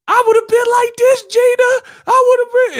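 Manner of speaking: loud, very high falsetto; Mama Payne. Retold or imitated. Mama Payne showing Gina the boxing moves, loud falsetto. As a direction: squawk it high and excited, like shadow boxing. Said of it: "he was playing Mama Payne".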